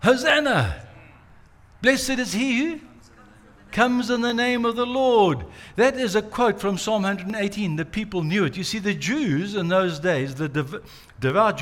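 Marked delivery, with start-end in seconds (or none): 0.0-5.5 high, loud festive cheer; ringing crowd shout of Hosanna; crowd acclaim. Retold or imitated. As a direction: cry it out like a welcoming crowd. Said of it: "remember what the crowd said".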